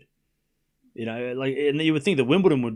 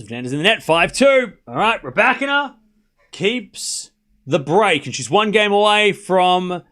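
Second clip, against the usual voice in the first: score called higher and louder, then stretched; live play-by-play; 6 semitones higher, 6 dB louder than usual. Real, not acted. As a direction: punch out the score, draw out the result. Live play-by-play, point decided.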